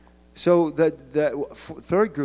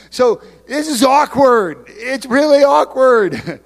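awkward; 10 semitones higher, 10 dB louder, slower than usual. Acted. Awkward remark, higher and louder.

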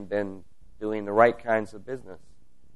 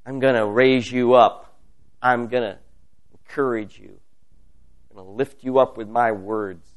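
usual speaking voice

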